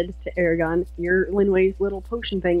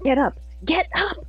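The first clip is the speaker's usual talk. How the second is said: high-pitched urging, rising on the repeat; urgent pleading; 7 semitones higher than usual. Acted. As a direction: plead for a fallen friend to rise. Acted urging of fallen Knight.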